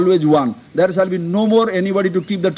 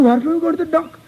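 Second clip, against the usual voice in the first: nosy visitor; 8 semitones higher than usual. Retold or imitated. Visitor asks in story.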